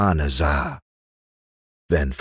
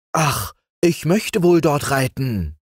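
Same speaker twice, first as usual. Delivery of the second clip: eager; 7 semitones higher than usual. A narrator's reading. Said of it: "sagte dann Franz eifrig".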